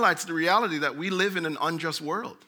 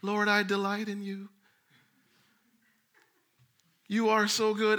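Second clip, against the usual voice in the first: rote prayer; 4 dB quieter than usual. Retold or imitated. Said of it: "repeat what other people say".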